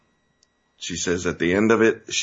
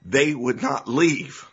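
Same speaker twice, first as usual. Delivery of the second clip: emotional; 7 semitones higher than usual. Real, not acted. Host moved by poll watchers who stayed, emotional raised voice. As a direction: voice rising with real emotion, pitch up. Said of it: "I don't know why I'm so emotional about this".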